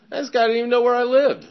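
high, loud disbelief; incredulous indignation. Retold or imitated. Newcomer about his would-be sponsor, incredulous. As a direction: pitch it way up, indignant.

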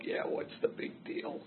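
near-silent, breathy shrug of a line; dismissive whisper. Retold or imitated. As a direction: brush her off under your breath. Shrugging off mom's question.

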